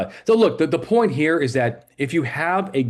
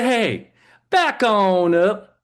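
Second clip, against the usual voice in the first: calling out; 9 semitones higher, much slower than usual. Retold or imitated. Cop telling partner to back off.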